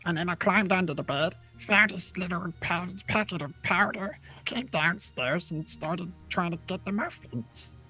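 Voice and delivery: high goblin voice. Acted. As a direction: pitch up into a sneaky goblin. DM voicing goblin Gum Gum.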